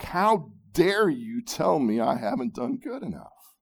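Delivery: loud, outraged, high-pitched protest; indignant shout. Pretend. Preacher voicing the religious heart defying grace, shouting. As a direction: shout back, wounded pride. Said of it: "The religious heart shouts back".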